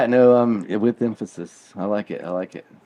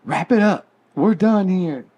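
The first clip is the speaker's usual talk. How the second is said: higher-pitched imitation of a man calling time; mock command, pitched up; mock emphatic order; 8 semitones higher than usual. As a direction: act out someone abruptly ending the party. Acting out the man in the video.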